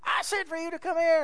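very high, strained mock yell; mock holler at a runaway child; imitated yelling parent. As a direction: act a parent at the top of their voice. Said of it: "they're yelling top of their voice".

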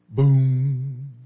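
a booming "Boom" like a struck gong; gong imitation. Shown as a demonstration. Pastor imitating a gong for the class, booming. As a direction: hit it like a gong. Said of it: "A gong makes what kind of sound?".

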